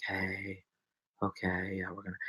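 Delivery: barely bother to speak; low-energy streamer. Retold or imitated. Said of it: "low energy".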